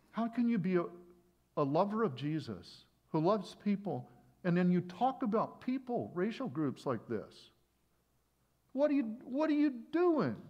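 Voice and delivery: high, loud acted-out scolding; harsh rebuke. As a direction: confront them with rising indignation. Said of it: "that's maybe a harsh way".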